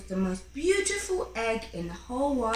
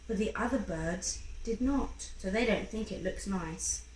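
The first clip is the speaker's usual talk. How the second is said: ordinary delivery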